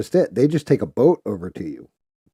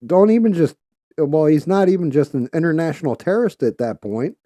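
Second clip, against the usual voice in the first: normal talking voice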